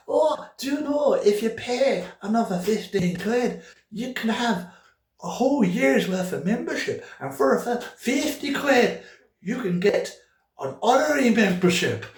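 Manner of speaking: high, put-on Scottish-Irish saleswoman; broad Scottish accent. Operator upsells membership to impatient caller, broad Scottish accent. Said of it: "she was an Irish, Scottish person".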